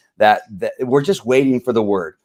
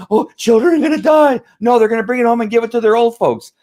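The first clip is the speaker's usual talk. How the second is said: much higher, louder hysterical mock voice; mocking shriek; 11 semitones higher, 4 dB louder than usual. Host mimics hysterical fearmongers, much higher and louder. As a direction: shriek like a panicked worrier.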